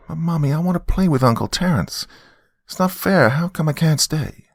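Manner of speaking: put-on high-pitched childish complaint; protesting little girl. Daughter wanting to stay with uncle, protesting child. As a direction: plead and object like a small girl.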